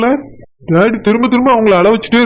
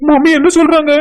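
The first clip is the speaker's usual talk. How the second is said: higher-pitched stunned questions; shocked; 5 semitones higher than usual. Acted. Son overhearing that he is adopted, shocked. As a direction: ask in startled disbelief, voice jumping up.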